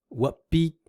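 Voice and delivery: WHAT gliding up, BEEP high and level; rising then flat. Shown as a demonstration. Teacher pairing second and first tones, rising then flat. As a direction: lift WHAT, hold BEEP even. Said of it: "find all the combinations".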